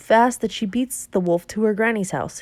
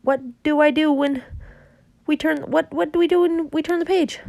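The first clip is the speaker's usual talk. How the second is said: 1 rising panic; 9 semitones higher than usual. Acted. Jack panics as they leave.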